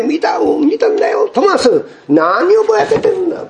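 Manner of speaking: high, eager insisting voice; excited disciples. Retold or imitated. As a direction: act out the disciples pressing Thomas. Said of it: "口々に".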